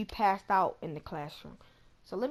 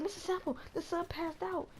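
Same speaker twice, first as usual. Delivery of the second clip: put-on high, breathless kid's alarm, a bit softer; 9 semitones higher, 4 dB quieter than usual. Student telling the bald teacher the sub fainted, high and urgent. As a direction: sound the alarm like a panicked student. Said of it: "He was like".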